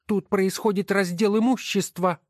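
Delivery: high, scornful woman's voice; wife's voice. Acted. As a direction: sneer at each title as you sort them. Wife answers her husband. (высокий насмешливый голос жены)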